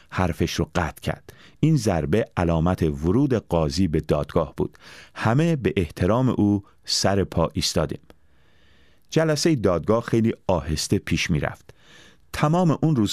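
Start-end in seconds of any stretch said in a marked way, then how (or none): none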